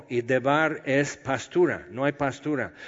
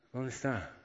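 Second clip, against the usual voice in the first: much softer, breathless wonder on "¿Dónde está?"; hushed amazement; 12 dB quieter than usual. Retold or imitated. David, moved to hear Jonatán's son lives, asks in hushed wonder. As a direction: let "¿Dónde está?" fall away softly, as if barely daring to ask. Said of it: "Imagina el corazón de David".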